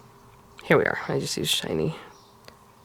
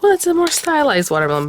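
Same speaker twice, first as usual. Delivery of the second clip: mock excuse; 10 semitones higher than usual. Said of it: "I could have been like".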